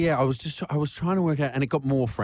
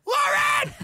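desperate shout. Retold or imitated. Imitating the trapped woman shouting for her housemate. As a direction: yell the name in panic. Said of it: "And then".

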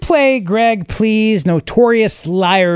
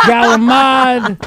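Italian-American voice; 3 semitones higher, 3 dB louder, slower than usual. Retold or imitated. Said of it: "Italian-American food names in his voice".

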